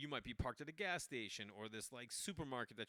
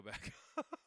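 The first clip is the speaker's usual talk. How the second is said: ordinary delivery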